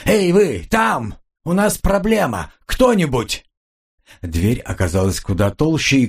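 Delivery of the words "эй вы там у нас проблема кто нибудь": bang on the door and yell. Acted. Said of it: "заорал".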